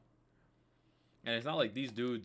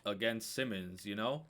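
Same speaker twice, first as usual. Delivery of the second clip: normal talking voice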